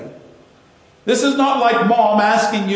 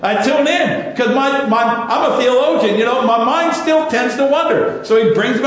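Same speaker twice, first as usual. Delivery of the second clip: ordinary talk, nothing marked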